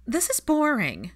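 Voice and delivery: high, slow sulking whine; a bored teenage sulk; sulky. Acted. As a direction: sulk it out, bored and put upon. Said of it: "The sulk in Pansy's tone".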